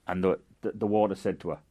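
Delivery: ordinary delivery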